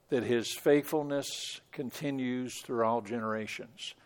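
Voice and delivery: quiet, low closing reflection on the psalm; soft reminder. Real, not acted. Pastor softly reminding the congregation, quiet and low. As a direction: lay it gently on the listeners. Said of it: "we need to be reminded of that".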